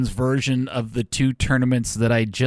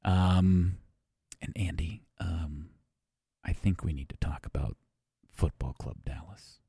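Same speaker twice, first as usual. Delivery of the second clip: hushed, much softer and lower; worried whisper; 6 semitones lower, 9 dB quieter than usual. Acted. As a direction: whisper like delivering grim news. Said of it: "Why are we whispering?".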